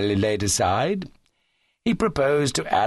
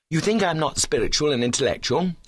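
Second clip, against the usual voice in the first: mildly wounded question, pitched up; understated stirred protest; deeply moved; 7 semitones higher than usual. A narrator's reading. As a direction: ask it with a touch of hurt feeling. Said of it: "said Cuthbert, deeply moved".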